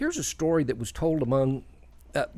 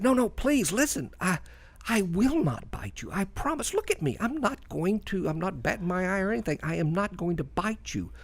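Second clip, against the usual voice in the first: higher-pitched, earnest begging as a storybook snake; pleading snake; 4 semitones higher than usual. Acted. Snake pleading with wary warrior.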